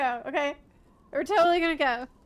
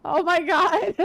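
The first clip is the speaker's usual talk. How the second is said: breathless relieved laughter; laughing; 4 semitones higher, 3 dB louder, slower than usual. Real, not acted. Relieved after the jump.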